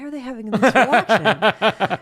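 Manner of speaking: slow, high, laughing puzzlement; laughing bewilderment. Retold or imitated. Author wondering why officers are so excited, laughing. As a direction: laugh through your confusion at the fuss. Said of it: "And I'm like".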